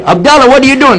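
high, loud call across the airport. Retold or imitated. Friends calling to a newcomer at the airport, loud call. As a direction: call his name in surprise.

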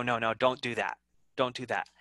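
ordinary talk, nothing marked

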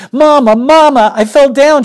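high, loud, breathless call for Mama; gasping cry. Acted. Ramona gasping to her mother after falling. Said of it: "she managed between gasps".

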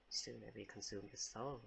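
lightly voiced menacing demon, hushed; a hint of a creepy demon murmur; low demon voice. Babysitter voicing the demon baby's threat, low hushed menace.

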